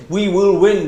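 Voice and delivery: rally the team with conviction; rallying cry. Shown as a demonstration. Teacher demonstrating a confident, rousing declaration. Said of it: "do you show it".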